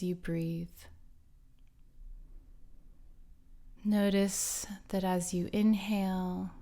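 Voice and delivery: slow guided meditation voice with long pauses; guided relaxation. Acted. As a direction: ease listeners into calm, phrase by unhurried phrase.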